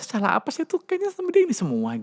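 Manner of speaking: high, exasperated mother's complaint; frustrated mother. Retold or imitated. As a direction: sigh it out like a fed-up mum.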